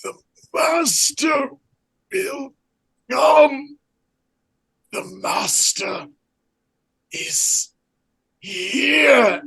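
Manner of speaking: gasping possessed voice; high, strained gasps between words; breathless fright. Acted. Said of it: "you hear his gasping voice".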